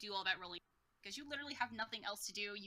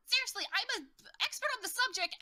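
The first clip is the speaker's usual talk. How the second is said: indignant; 10 semitones higher, 4 dB louder than usual. Retold or imitated. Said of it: "what she said was".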